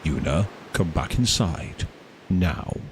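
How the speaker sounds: low, slow, let-down father; disappointed dad voice. Retold or imitated. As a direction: sound like a weary disappointed dad. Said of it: "such a disappointed dad voice".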